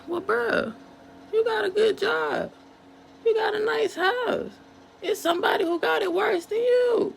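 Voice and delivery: high, mocking voice of a know-it-all friend; sing-song mock lecture, rising to a shout; mocking dismissive friend. Mocking people who dismiss pain.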